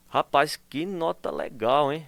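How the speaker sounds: slow, drawn-out mock praise; ironic. Shown as a demonstration. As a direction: praise the bad grade sarcastically, slowly. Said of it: "você tá ironizando com a pessoa". (elogio irônico e lento, zombando da nota)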